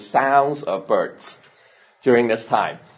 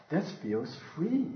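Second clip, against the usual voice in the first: softer and slower, in awe; hushed wonder; 10 dB quieter, slower than usual. Retold or imitated. Baby bird discovering flight, hushed and slow. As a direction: breathe the line out in wonder. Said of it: "it realizes".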